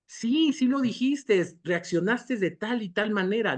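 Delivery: high-pitched acted insistence; insistent contradiction. Witness tells the forgetful person what they did, insisting. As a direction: push back in a re-enacted argument. Acts out listener insisting it happened.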